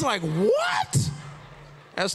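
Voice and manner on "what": high rising outraged what; shocked disbelief. Retold or imitated. Reacting to his brother's walk it off, high and shocked. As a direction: squeal in outrage.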